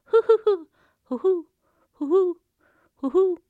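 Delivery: high hooting laugh easing off; laughing as she turns into an owl. Acted. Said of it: "the girl continued to laugh".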